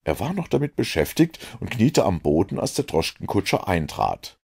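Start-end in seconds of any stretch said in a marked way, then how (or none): none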